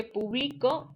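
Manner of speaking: publico with the stress on BLI; stress on middle syllable. Shown as a demonstration. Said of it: "No se escucha igual". (publico con acento en la sílaba del medio, BLI)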